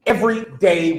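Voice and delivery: usual speaking voice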